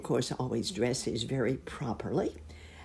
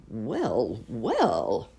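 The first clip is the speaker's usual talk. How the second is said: surprised well well; 3 semitones lower, 3 dB louder than usual. Acted. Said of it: "exclaimed Uncle Wiggily in surprise".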